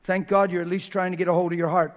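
loud, rising preacher's call; preaching. Real, not acted. Pastor preaches to the church at full force. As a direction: drive it home to the congregation.

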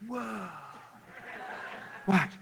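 hushed, slow amazement, half whispered; soft, astonished near-whisper; retold awestruck surprise. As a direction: imitate her stunned reaction, softly, with pauses. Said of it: "she was fired up".

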